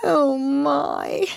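high, plaintive lament; miserable moaning. A narrator's reading. Mole lamenting his cut shin, miserable moaning. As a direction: moan it in self-pity. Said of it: "said the mole miserably".

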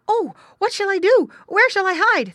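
high, loud, panicky piglet voice; frightened little pig. A narrator's reading. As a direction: cry out as a piglet desperate to hide. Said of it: "thought Squinty".

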